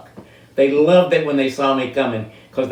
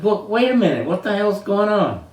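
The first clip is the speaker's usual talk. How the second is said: higher, alarmed demand for answers; indignant protest; 8 semitones higher than usual. Retold or imitated. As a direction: catch him before he walks out. Retold protest to departing doctor.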